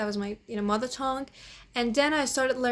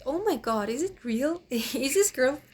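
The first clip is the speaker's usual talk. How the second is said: retold disbelief, higher at the end; amazed reaction; 4 semitones higher, 5 dB louder than usual. Polyglot retelling her first sight of Claire's page, amazed. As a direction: retell your stunned reaction, breaking into a laugh. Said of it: "I was like".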